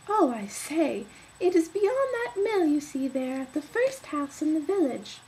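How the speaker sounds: put-on high childlike voice; eager little girl explaining the way; bright high child's voice; girl's voice. Girl answers wolf.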